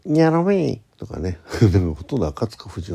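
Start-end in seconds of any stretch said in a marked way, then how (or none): 0.1-1.0 cartoon voice. Quoting cartoon catchphrase.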